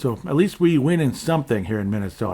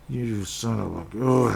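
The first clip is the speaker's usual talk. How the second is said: mad curse; slower than usual. Retold or imitated. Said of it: "I'm so mad".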